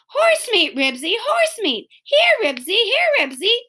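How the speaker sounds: high, urgent tempting call; calling a dog. Acted. Henry lures Ribsy with horse meat, urgent calling.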